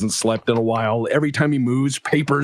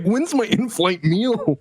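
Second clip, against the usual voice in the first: drawn-out, higher mock complaint; mock passenger; 7 semitones higher, slower than usual. Game master mimicking a player's passenger, slow mock whine. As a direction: stretch it out like a needy passenger. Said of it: "You're like".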